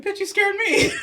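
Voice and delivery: high, loud laughing yelp. Retold or imitated. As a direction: squeal it out through a laugh. Retold fright, laughing.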